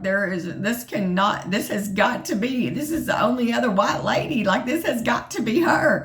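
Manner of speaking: confused. Retold or imitated. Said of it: "so confused".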